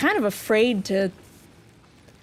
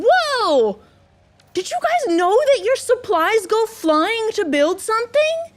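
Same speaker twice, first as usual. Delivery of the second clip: excited discovery; 11 semitones higher than usual. Real, not acted. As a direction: share the discovery with delight. Supplies fly to build.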